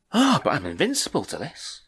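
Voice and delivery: gasped, high swooping mock realization; mock sudden realization. Film character suddenly finding a way out of the curse, mock surprise. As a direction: play a hammed-up film character, pitch leaping up and down. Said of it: "where someone goes".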